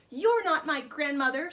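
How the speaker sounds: put-on girl screaming at the wolf. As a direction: shout it at the wolf.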